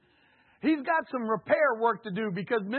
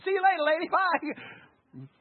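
higher, louder breezy farewell; dismissive brush-off; 10 semitones higher, 7 dB louder, slower than usual. Retold or imitated. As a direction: wave her off and leave quickly. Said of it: "I would have went in there and said".

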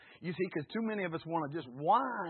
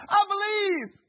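very high, loud cry of faith; acted desperate shout, sustained high; 13 semitones higher, 10 dB louder, slower than usual. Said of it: "cried out and said".

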